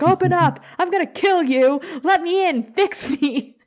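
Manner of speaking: high-pitched, frantic retold yell; mock freak-out. Spike at the vanished lab door, mock frantic freak-out. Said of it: "he starts freaking out".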